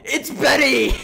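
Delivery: imitating her nonstop screaming; mock scream. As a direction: shriek your own name. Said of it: "she doesn't stop screaming".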